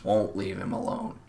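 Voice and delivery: usual speaking voice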